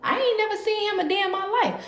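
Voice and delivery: feigned innocence, softly voiced; soft "no", then high innocent denial; playing it off. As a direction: act clueless, keep it soft. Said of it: "I'm playing it off like".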